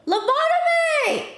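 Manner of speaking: high, loud eureka shout; excited shout. Real, not acted. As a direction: yell it like you've just found it. Finally finds the word.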